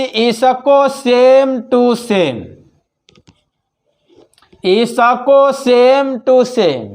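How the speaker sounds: each word stretched out, very slow; slow dictation. Shown as a demonstration. Teacher repeating the formula for students, very slow. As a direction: dictate it slowly so they can write.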